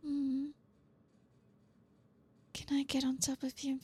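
ordinary delivery

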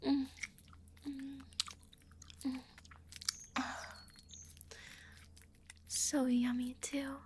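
talking through a mouthful; mouth full. Acted. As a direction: say it round a mouthful. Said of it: "Stop talking with your mouth full".

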